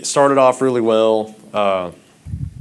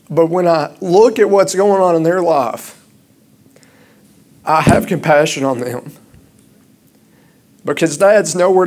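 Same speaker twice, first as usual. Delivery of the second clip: louder, higher, urgent sermon voice; impassioned preaching; 7 semitones higher, 4 dB louder than usual. Acted. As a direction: preach it hard to the congregation. Pastor preaches on absent fathers.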